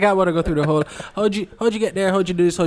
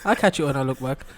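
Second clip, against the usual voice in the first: usual speaking voice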